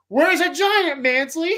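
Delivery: mock alarm; alarmed general. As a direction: snap the question at him. General questioning Kent.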